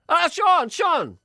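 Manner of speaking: high, loud call dropping on the repeat; loud demo call of a friend's name. Shown as a demonstration. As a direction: call across the street. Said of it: "Like that".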